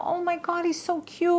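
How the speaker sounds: put-on high cooing over cute kids; cooing voters. Voters gushing over the judge's kids, high cooing. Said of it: "they go".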